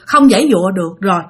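usual speaking voice

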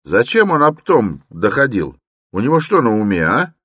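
high, loud questioning; suspicious townsman. A narrator's reading. Townsman urges porters against Raskolnikov, loud and suspicious. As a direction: rouse the others with suspicion.

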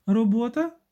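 high, rising, pointed question; pointed disbelief. A narrator's reading. Jealous wife challenges his excuse, pointed and high. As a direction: ask it high, as if daring him to confirm. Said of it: "спитала Віра таким тоном, ніби наголошувала".

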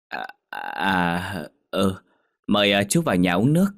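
stalling, then rushed invitation; awkward hesitation, then quick; flustered welcome. Acted. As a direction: stumble into a polite invitation. Flustered host invites old acquaintance.

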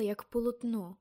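usual speaking voice

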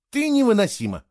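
high-pitched, astonished reproach; stunned. A narrator's reading. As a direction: say it as if struck by the discovery. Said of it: "поражённо сказал Фло". (поражённо, высоким голосом)

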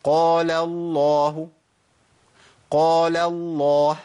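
slow recited قال الله, light قال into heavy الله; correct lam weights. Shown as a demonstration. Teacher shows how lip shape fixes it, correct lam weights. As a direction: hold قال الله long, switching from thin to thick. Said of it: "هيخلص معايا المسألة ديت هيئة الشفتين".

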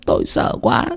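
breathless terrified hush; frightened whisper. Acted. A mother whispering her nightmare, terrified. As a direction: whisper it in fear.

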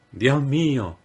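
a high, moaning plea of dismay; a touch of a whimpering woman. A narrator's reading. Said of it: "gimió Lily".

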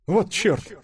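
high-pitched startled exclamation; alarmed curse. A narrator's reading. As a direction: blurt out a curse mid-fall. Falling character swears in alarm. (испуганный возглас, высоко)

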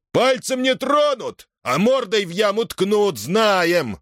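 high, scornful angry cry; heckling outburst. A narrator's reading. Platon cannot hold back and heckles Timofei, scornful. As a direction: burst in, drawn out then heated. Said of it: "не удержался Платон".

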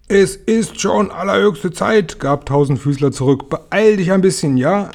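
impatient shout. A narrator's reading. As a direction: hurry the glow-worm along angrily. Centipede yells at glow-worm. (ungeduldig, höher und langsam)

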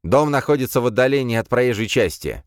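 shouted over shoulder; shouting. Acted. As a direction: call out while running off. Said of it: "крикнул он через плечо".

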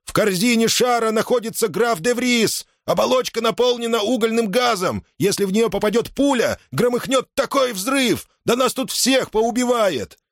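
high, sustained warning shout; agitated high-pitched cry; alarmed shouting. A narrator's reading. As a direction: yell a frantic warning at them. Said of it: "закричал он".

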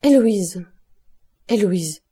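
name cried twice, easing slightly; alarmed calling. A narrator's reading. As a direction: call out to a stricken wife. Husband calls his stricken wife.

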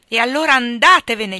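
loud, sharp cry of go away then; shouted dismissal. A narrator's reading. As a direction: fling it at him in a burst. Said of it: "gli ho gridato". (gridato con forza)

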